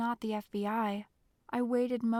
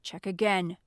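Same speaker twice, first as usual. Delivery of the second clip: loud, curt order; exasperated boom; 3 semitones lower, 5 dB louder than usual. A narrator's reading. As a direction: bark it at a subordinate, fed up. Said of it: "voice boomed with exasperation".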